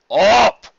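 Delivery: loud, short order; firm command. Acted. Big Macintosh orders Carrot Top up, loud. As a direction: bark it once. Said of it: "repeated Big Macintosh".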